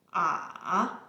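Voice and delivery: low dipping "Ah" then rise; third tone. Shown as a demonstration. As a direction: dip "Ah" to the bottom and climb out. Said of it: "a little complicated, as it goes descending first".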